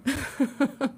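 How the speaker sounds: short, high laugh. Real, not acted. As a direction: laugh off the awkward question. Laughs after declining an opinion.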